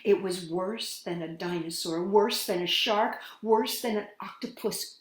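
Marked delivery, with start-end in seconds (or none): none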